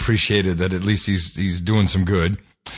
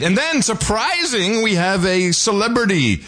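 excited; 11 semitones higher than usual. Acted. Announcing a celebrity PR associate.

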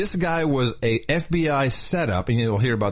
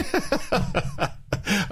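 real burst of laughter; laughing; 8 semitones higher, 3 dB quieter than usual. Real, not acted. Host cracks up at co-host's birth control joke, laughing.

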